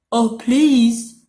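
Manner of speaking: sniffy wolf. A narrator's reading. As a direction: sniff through a stuffy nose. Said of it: "sniffed Big Bad".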